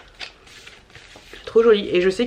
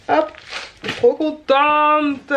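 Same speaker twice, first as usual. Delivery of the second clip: drawn out slowly and pitched up; delighted; 6 semitones higher, much slower than usual. Real, not acted. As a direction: savour it, really pleased. Delighted over her muesli haul. (ravie, en traînant les mots)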